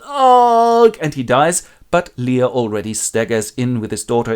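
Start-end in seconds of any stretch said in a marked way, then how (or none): 0.1-1.0 loud high mock death grunt; death groan. Edmund dies with a loud comic ugh.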